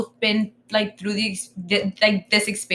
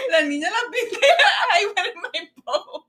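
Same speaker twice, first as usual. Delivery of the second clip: cracking up, pitch high; laughing; 10 semitones higher than usual. Real, not acted. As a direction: joke through laughter. Joking about sailing like Columbus.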